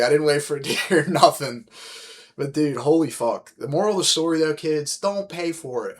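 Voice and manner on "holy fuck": ordinary talk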